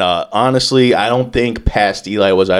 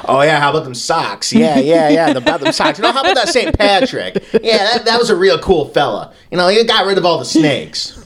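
high-pitched, badly faked Boston bar guy; botched Boston accent; 7 semitones higher than usual. Guy in a Boston bar praising Saint Patrick, botched Boston accent. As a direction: attempt a Boston accent and miss, voice pushed up high. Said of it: "I don't know what fuck accent that was".

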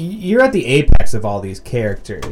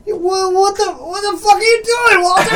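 much higher, loud disbelieving cry; mock bewildered shout; 4 dB louder than usual. The dude confronting Walter, high disbelieving shout. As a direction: yell it in shocked disbelief. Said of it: "you're like".

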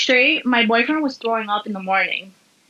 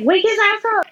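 excited friends; 10 semitones higher than usual. Retold or imitated. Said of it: "And they're like".

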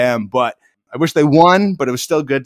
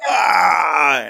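crowd groan; 4 semitones higher than usual. Retold or imitated. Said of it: "we're all like".

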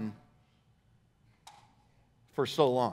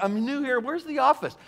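higher-pitched mock newcomer question; lost newcomer; 9 semitones higher than usual. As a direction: imitate a puzzled visitor asking the way. Voices a lost newcomer asking.

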